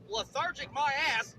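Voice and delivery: usual speaking voice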